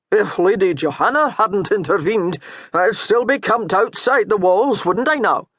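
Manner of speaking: a touch of teasing challenge; lightly voiced baiting priest. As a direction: poke at him with the question.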